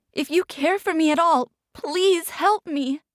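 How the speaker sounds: high, pleading, slowing at the end; desperate begging. Acted. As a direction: beg with everything left. Begging Bay for help.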